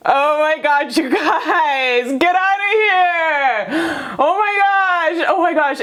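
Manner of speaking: high, thrilled squeal of disbelief; can't believe how good this reading is; excited squeal. Real, not acted. Reader shrieking with joy at the viewer's cards, high and thrilled. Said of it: "like, the kind of excited".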